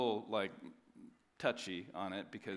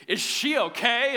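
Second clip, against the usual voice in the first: high, loud, slow mock concern; mock fussing concern; mock concerned asker; 12 semitones higher, 13 dB louder than usual. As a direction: ham up people asking with worry. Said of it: "they come up and be like".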